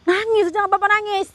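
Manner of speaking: a high, loud coaxing call. Real, not acted. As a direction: call out to reassure the crying spirit. Calling to the crying spirit.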